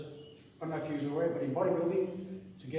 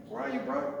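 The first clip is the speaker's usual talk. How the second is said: put-on Maori accent; 6 semitones higher than usual. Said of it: "in the Maori accent".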